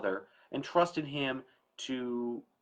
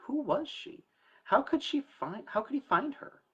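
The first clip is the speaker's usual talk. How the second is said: puzzled prince. Acted. Narrator voicing puzzled prince.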